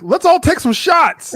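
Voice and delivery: high, slow, teasing impression of a friend; sing-song mock invite; mock party-girl voice. Friend urging shots, in a high mocking voice. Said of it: "I was like".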